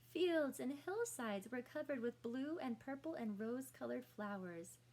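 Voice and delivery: plain reading voice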